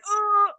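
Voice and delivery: high, loud wail of pain; hammed-up agony cry; mock dying groan. Patient retelling her first nasal spray, mock dying wail. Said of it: "like, dying".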